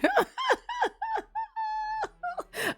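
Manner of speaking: high helpless laugh; real laugh breaking through; real laughter. Real, not acted. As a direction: burst out laughing. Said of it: "I'm sorry, I'm laughing".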